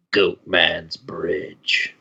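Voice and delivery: slow, low, drawn-out ominous title; spooky legend name. Shown as a demonstration. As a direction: draw each word out low and slow. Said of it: "you have to say it like that".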